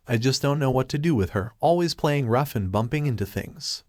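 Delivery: ordinary delivery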